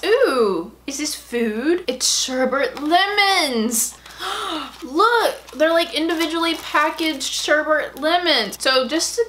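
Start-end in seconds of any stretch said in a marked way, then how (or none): 4.2-4.8 quiet delighted gasp; small gasp of delight; soft gasp. Real, not acted. Soft gasp at the sherbet lemons. Sees candy packaging.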